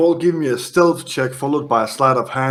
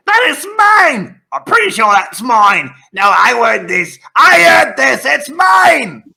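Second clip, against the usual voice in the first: high, loud screeching imp voices squabbling over loot; 10 semitones higher, 6 dB louder than usual. Acted. The GM voices imps clawing at the table, screeching. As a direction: shriek it like greedy little imps.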